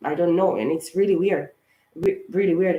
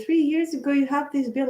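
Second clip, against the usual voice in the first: imitating the lady; 8 semitones higher than usual. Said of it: "this lady told me".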